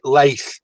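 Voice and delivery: 'Leith' with a closing fricative sound; local pronunciation. Shown as a demonstration. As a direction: say 'Leith' as locals say their town. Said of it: "but we pronounce it".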